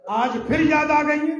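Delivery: a cry of grief to his master; anguished cry. Acted. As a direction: break out with sorrow. Qambar's emotional plea.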